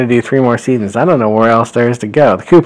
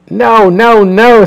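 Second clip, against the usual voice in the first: much higher, louder panicked wail; horrified no; 12 semitones higher, 8 dB louder, much slower than usual. Acted. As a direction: squeal the refusal. Mauser horrified at sandworm.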